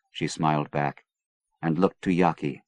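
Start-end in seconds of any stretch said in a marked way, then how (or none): none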